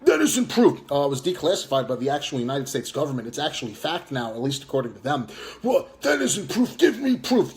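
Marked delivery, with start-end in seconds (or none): 0.0-0.8 higher and louder sneering imitation; mocking high retort. 5.6-7.5 higher-pitched put-on whiny voice; mocking squeaky parody. Mocking the opponent's catchphrase.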